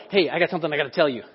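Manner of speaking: fast conspiratorial gossip; gossiping aside. Retold or imitated. Preacher mimics a gossip working the crowd, quick and hushed. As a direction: rush it like passing a secret. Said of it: "If I'm going".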